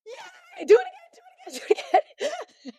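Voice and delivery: squealing cheer. Retold or imitated. Kids at the cakewalk cheering for a redraw, squealing and laughing. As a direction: squeal like a thrilled kid begging for another draw.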